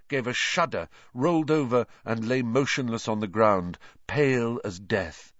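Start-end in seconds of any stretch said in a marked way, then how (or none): none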